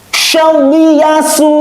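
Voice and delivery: high, drawn-out chanting of the syllables; Quran recitation. Shown as a demonstration. Teacher recites for pupils to copy, high and drawn out. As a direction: chant it like a tajwid teacher showing the sound. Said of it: "Perhatikan Ustadz".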